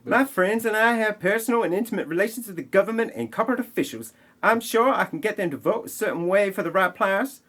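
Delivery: high, fast smug schemer voice; mock villain voice. Recapping the movie, voicing the corrupt insider in a high, quick mock voice.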